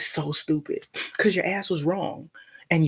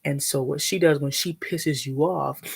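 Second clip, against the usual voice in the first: ordinary talk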